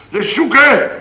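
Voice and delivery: high, loud order to search; urgent command. Acted. Flustered professor ordering the room to search. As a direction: push them to hunt.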